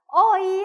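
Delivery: 어이 misread as two separate vowels, high and loud; wrong reading. Shown as a demonstration. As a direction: say 어이 with an open 'eo' gliding into 'i'. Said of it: "하시면 안 됩니다".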